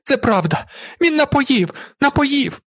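very high, frantic female cry; desperate shrieking. A narrator's reading. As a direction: shriek it out in despair. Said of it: "Дівчина в розпачі верещить".

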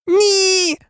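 very high screamed refusal; high, desperate girl's screech. A narrator's reading. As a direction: shriek it in panic. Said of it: "заверещала Герміона".

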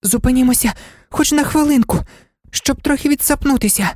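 high-pitched, winded begging; breathless pleading. A narrator's reading. Exhausted Alice begs the king to stop, breathless pleading. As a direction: plead for a rest, short of breath. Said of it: "благала задихана Аліса".

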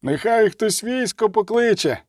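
high-pitched alarmed call. A narrator's reading. Miner wants the army fetched, calling out high. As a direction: call it high over the crowd.

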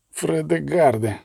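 slow, laboured call of a wounded man; with effort. A narrator's reading. Wounded Веng calling his comrade, with effort. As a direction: force the name out weakly. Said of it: "із зусиллям відізвався Венг".

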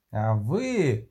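ordinary delivery, nothing marked

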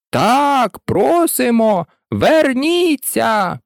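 high, drawn-out pleading call; chorus calling out. A narrator's reading. Everyone begging the mouse to return, drawn-out chorus call. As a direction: stretch the words out, high and imploring. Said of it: "Всі присутні хором приєдналися".

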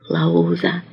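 very soft, low whisper. A narrator's reading. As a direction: whisper it with the lips alone. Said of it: "шепоче він самими губами". (шепіт самими губами)

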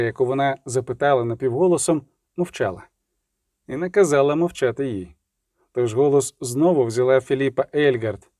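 usual speaking voice

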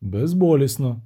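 ordinary delivery, nothing marked

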